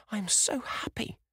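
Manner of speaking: put-on high, bright young girl's voice; a daughter bursting with happiness; high breathless girl, overjoyed; girl's joyful cry. A narrator's reading. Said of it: "she repeated".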